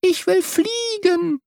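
put-on high, drawn-out baby-bird cheep; peeping chick. A narrator's reading. Newly hatched bird longs to fly, peeping. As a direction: peep it high and slowly, like a hatchling.

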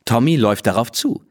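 plain reading voice

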